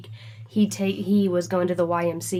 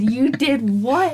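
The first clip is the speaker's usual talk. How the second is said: mock disbelief; 5 semitones higher, 5 dB louder, much slower than usual. Mock shock at broken bracelet.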